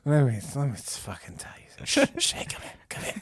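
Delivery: hushed, conspiratorial beckoning; tipsy creepy mother. Acted. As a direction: shush and coax in a whisper. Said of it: "she takes another sip from her wine".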